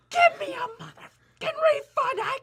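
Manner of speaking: very high, full-volume shriek; hammed-up screech of an outraged customer; imitating screaming customer. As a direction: scream it at the top of your lungs. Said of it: "she screamed at the top of her lungs".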